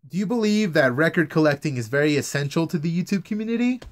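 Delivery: ordinary talk, nothing marked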